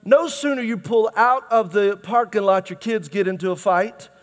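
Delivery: normal talking voice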